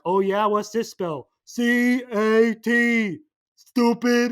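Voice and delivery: mocking camp guard testing the kid; mock Tim Blake Nelson. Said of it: "Tim Blake Nelson's like".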